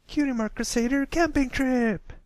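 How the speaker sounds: high, loud excited filly shout; shouted at the top of lungs. Acted. Fillies announce their trip to the colt, loud high shout. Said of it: "shouted at the top of their lungs".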